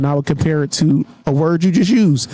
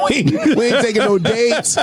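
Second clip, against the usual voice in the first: higher, laughing through the words; 5 semitones higher than usual. Real, not acted. Man joking to co-hosts about skipping dates, laughing. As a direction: crack up while making the joke.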